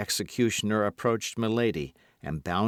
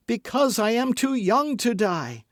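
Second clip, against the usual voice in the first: very high, loud desperate cry; crying out in fear; 14 semitones higher, 5 dB louder than usual. A narrator's reading. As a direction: plead while struggling. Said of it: "cried Milady, struggling".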